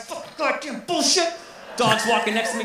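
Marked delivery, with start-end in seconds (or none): 0.0-1.3 muttered curse burst. Retold or imitated. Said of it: "I got, like, Tourette's".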